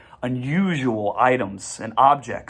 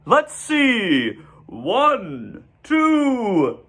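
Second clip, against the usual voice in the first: high, sing-song counting; delighted counting; 12 semitones higher, much slower than usual. A narrator's reading. Mr Zuckerman counts the goslings in a high delighted voice. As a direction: count each gosling with glee.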